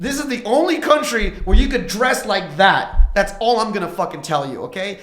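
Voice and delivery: retold telling-off of his cross-dressing friend, loud and heated; angry rant. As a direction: lay into him at full volume. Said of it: "I was like".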